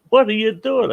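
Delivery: high, startled yelp of a coworker; surprised shout. Retold or imitated.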